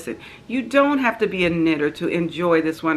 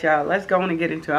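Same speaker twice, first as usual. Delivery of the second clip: normal talking voice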